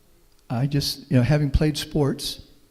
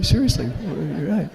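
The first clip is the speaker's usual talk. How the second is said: laughing; 3 semitones higher, slower than usual. Real, not acted. Laughs at his own joke.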